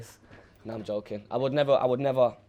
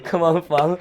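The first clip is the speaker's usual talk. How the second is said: laughing; 6 semitones higher, 4 dB louder, slower than usual. Real, not acted. Laughing at his own regret.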